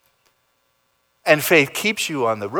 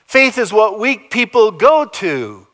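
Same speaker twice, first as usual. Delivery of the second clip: mock scornful skeptic; 10 semitones higher, 7 dB louder than usual. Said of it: "mocking skeptic quote".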